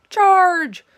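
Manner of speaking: high, loud battle cry; squeaked charge. Acted. Hamster charging like a rhino, high loud yell. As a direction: shout it as you charge. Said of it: "I squeaked".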